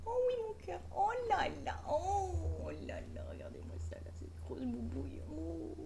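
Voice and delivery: high cooing pet voice. Real, not acted. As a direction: coo adoringly at the cat. Cooing at her cat.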